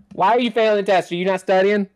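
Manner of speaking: high, loud mock mom yell; high loud mother demanding answers. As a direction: shout it like an angry mom. Mom yelling on phone.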